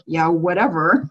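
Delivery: low, slow, bored shrug of a reply; dismissive. Retold or imitated. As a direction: brush it off like it means nothing. Unimpressed reply to someone's holding.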